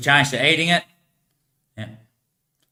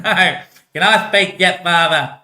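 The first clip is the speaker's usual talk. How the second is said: higher, louder, drawn-out plea; exasperated, stretched-out asking; impatient pleading; 5 semitones higher, 5 dB louder, much slower than usual. Acted. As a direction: nag father slowly and insistently. Son pleads repeatedly with father.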